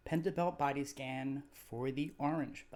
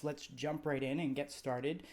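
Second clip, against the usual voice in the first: ordinary talk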